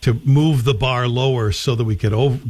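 normal talking voice

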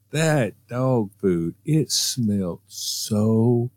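very slow, drawn-out disgust. Real, not acted. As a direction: stretch it out in disgust.

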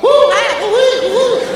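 high-pitched comic whooping; string of silly high hoots; zany Marx Brothers whoops. Acted. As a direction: whoop madly like slapstick orderlies. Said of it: "The Marx Brothers jump out".

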